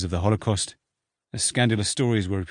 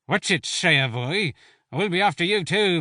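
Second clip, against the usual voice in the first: leering; 9 semitones higher than usual. A narrator's reading. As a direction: sneer it at the captain. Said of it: "the veteran leered".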